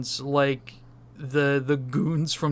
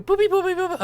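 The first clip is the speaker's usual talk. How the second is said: high, loud mouthed boop-beep computer noise; machine boops; 7 dB louder, much faster than usual. Pretend. Pretend machine running the numbers, high loud booping. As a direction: imitate a computer crunching numbers. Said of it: "it's running the numbers right now".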